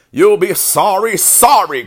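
high, loud warning call; goose calling out. A narrator's reading. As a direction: call after someone as a scolding goose. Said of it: "called the goose".

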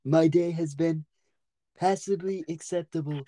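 measured, carefully pronounced mock-dignified voice; put-on formal character. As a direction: answer in a stiffly formal put-on voice. Stays in put-on voice.

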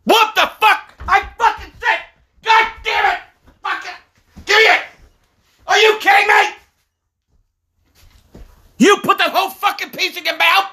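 high-pitched incredulous shout; outraged yelling. Real, not acted. Parent scolding kid who took the whole piece, outraged yelling.

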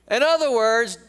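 normal talking voice

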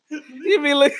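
laughing through the words. Real, not acted. Mocking face while laughing, giggly. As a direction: let a giggle break it. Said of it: "Lisa can't see the face you making".

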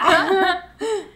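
loud high burst of laughter. Real, not acted. Friend cracking up at her own jab, high and loud. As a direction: laugh out at your own joke.

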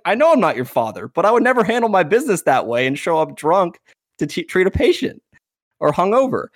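indignant dad. Retold or imitated. Dad rebukes the trainer for showing up drunk, indignant. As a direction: imitate a parent firmly taking offence.

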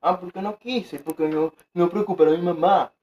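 high, whiny imitation of regret; hammed-up lament, mocking his friend; mock regretful friend. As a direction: pretend to whine about a missed night out.

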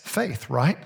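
normal talking voice

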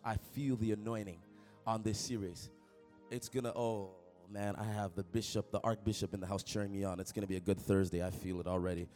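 ordinary talk, nothing marked

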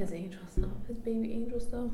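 put-on fast, breathy baby-talk whisper. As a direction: coo it in a whisper. Teasing whisper about the dog.

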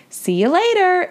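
put-on high, girlish parting call. A narrator's reading. Annie waves goodbye to Sylvia, calling out. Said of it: "Annie called".

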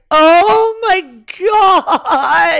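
drawn-out mock wail, very high and loud; hammed-up cry of despair, stretched right out; panicked. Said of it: "I'm panicking".

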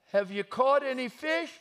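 slow, high, drawn-out long-distance call; yelling out. Acted. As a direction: holler across the water from the shore. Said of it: "the man yells out".

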